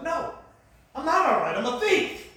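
loud, high, agitated denial; heated protest. Retold or imitated. Dale refusing his pastor's reassurance, heated protest. Acted argument, protesting loudly.

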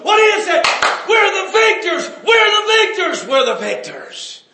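high, loud, shouting celebration; preaching at full force. Acted. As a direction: shout the victory like the crowd.

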